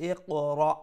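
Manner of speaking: "ইকরা" with a firm, lightly stopped q; firm articulation. Shown as a demonstration. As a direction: say "ইকরা" firmly, q stopped without choking the breath. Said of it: "কিন্তু শক্ত করে উ-, উচ্চারণ হইতো".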